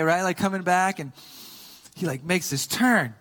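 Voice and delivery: normal talking voice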